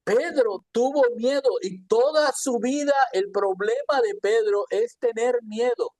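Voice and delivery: normal talking voice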